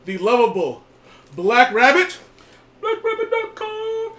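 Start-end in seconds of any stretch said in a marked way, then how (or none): 1.4-4.1 high announcer flourish; ringmaster. Acted. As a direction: hype up the name. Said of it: "I am your host".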